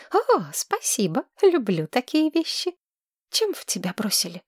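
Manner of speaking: put-on high, breathless girl gushing in love; dreamy young woman. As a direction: swoon over him like a smitten girl. Tamira voice again.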